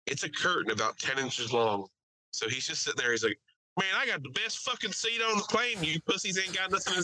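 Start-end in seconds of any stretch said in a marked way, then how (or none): 3.8-6.9 high-pitched mock bragging; imitated trash talk. Rowdy passenger taunting his boys about his seat, bragging. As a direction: crow at your boys in a raised voice. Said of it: "he's, like, talking shit to his boys".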